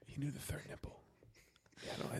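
soft secretive whisper. Real, not acted. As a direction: whisper it, almost breathless. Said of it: "Why are we whispering?".

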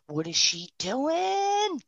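drawn-out, awed question; stunned disbelief. Retold or imitated. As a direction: ask it as if you can't believe it. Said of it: "that's when I went".

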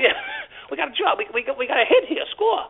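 high, rising thrill on "Score"; excited. Retold or imitated. A retired cop announcing a score, high and excited. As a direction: build to the word "Score" with glee. Retelling partner's excited call.

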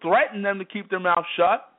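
ordinary talk

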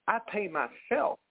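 ordinary delivery

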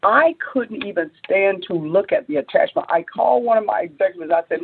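ordinary delivery

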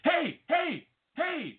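very high squealed hailing; over-eager calling out. Pretend. Advising friend how to greet the singer, high hammed-up calling. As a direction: yelp it repeatedly like a pestering fan. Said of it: "and you would have been like".

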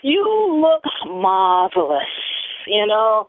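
slow, grand compliment; campy diva voice. Acted. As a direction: purr it like a diva.

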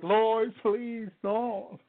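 pleading as if about to cry; retold tearful plea. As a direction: imitate begging through tears. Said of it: "I started crying like a baby".

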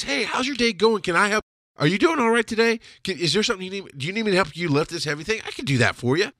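high-pitched, eager offers of help; friendly helpfulness. Acted. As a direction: greet a coworker like a valued customer. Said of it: "I'm treating you like I treat the customer".

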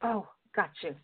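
ordinary talk

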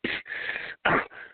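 a long breathy hiss through the teeth; quiet hiss imitated for the listener. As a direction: mimic the row of people hissing. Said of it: "they just started hissing".

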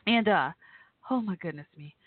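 normal talking voice

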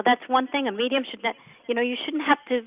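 ordinary talk, nothing marked

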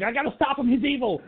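high-pitched, fast-rising mock alarm; frantic Loomis. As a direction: act out a panicked man raising the alarm. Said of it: "running around with his gun going".

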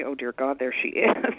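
the dreading thought replayed aloud for laughs; voiced-out inner groan, breaking into a laugh after; mock dread. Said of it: "I don't wanna have that thought about somebody".

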